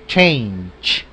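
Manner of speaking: change with a crisp ch at the end; change, ending clearly on ch; English change, ch ending. Shown as a demonstration. Teacher stressing the final ch for the class. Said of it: "la C H al final".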